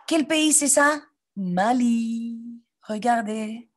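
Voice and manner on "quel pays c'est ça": high, wondering question; mock surprise. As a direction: gasp and wonder aloud. Gasps asking class. (question étonnée)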